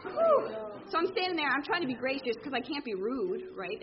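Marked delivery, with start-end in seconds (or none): 0.0-0.5 high, loud exhale of relief; loud whew. Real, not acted. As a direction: blow out a big whew. Relief after escaping the girls.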